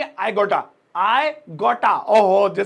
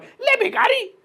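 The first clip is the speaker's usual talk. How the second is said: much higher, a shrill scolding auntie; mock auntie voice; 13 semitones higher, slower than usual. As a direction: toss it off like an annoyed auntie. Auntie answers the beggar. (दोस्त की aunty की ऊँची, चिढ़ी हुई नकल)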